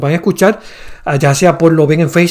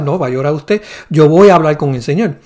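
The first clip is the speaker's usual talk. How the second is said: ordinary talk, nothing marked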